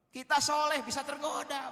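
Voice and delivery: high, loud warning; preaching shout. Real, not acted. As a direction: cry it out as a warning to the men. Preacher warns the congregation. (peringatan keras dan tinggi kepada jemaah)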